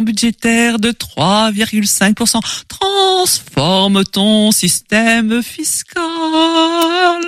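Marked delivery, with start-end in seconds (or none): 2.7-7.2 very slow, high, eerie; siren voice. Acted. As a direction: stretch it like a spell. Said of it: "des voix suaves".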